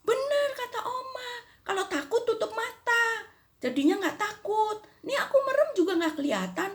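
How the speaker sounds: put-on high, laughing little girl's voice; high childlike voice with a laugh; child's voice. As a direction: chatter like a delighted child. Child reports to grandma.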